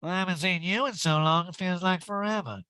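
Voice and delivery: high mimicked greeting; Erica impression. Erica greeting Dorit, high put-on voice. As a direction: pitch it up, putting on her voice. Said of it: "Erica to Dorit".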